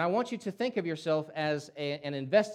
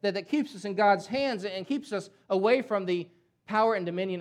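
louder, higher, hammering key words; forceful preaching; 5 semitones higher, 6 dB louder than usual. Real, not acted. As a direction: preach it with full conviction.

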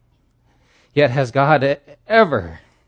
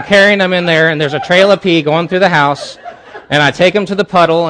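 louder, higher, laughing through the words; laughing while telling; 6 semitones higher, 8 dB louder than usual. Real, not acted. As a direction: tell it while cracking up. Laughing while retelling mess.

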